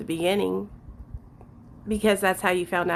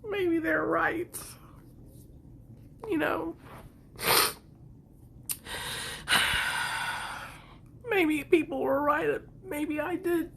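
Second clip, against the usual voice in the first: tearful; 8 semitones higher, 6 dB quieter than usual. Real, not acted. Still tearful.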